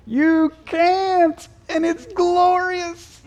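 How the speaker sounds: very high, drawn-out exclamation; exultant. Real, not acted. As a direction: proclaim it slowly at the top of your range. Exultant answer to acted question.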